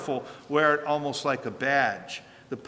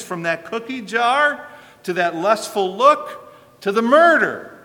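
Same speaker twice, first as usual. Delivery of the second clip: rising, louder preaching build; fervent preaching; 4 semitones higher, 9 dB louder than usual. Acted. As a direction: drive the point home to the congregation. Preacher building to full force.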